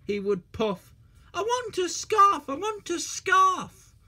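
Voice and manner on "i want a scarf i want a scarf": high, sing-song little engine; whiny little engine. A narrator's reading. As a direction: chant it like a pleading child. Percy chanting his wish.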